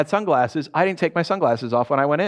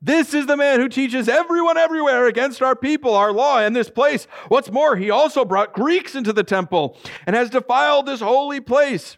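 shouting mob; 10 semitones higher than usual. A narrator's reading. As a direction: raise the alarm, crying for help.

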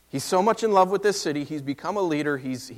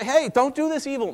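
pleading call; 11 semitones higher, 4 dB louder than usual. Retold or imitated. Lot pleading with the mob.

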